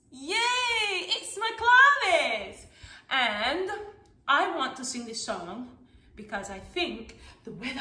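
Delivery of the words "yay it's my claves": excited cheer. Real, not acted. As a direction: cheer it slowly and high. Cheering with children after call game.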